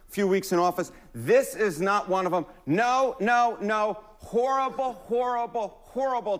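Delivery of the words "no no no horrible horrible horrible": a light take on Charles Barkley's "horrible"; quoting Barkley with a lightly put-on voice. Pundit quoting Barkley on the tariff poll, lightly imitated. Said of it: "to quote Charles Barkley".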